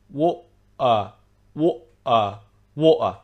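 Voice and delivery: water broken in two by a glottal stop. Shown as a demonstration. Teacher breaking down the glottal stop, water without T. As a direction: stop the air mid-word in water, no T. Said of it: "we restrict the flow of air".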